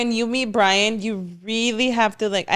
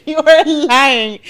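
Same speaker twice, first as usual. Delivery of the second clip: high, loud, slow laughing jab; laughing accusation; 5 semitones higher, 6 dB louder, slower than usual. Real, not acted. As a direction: tease him while laughing.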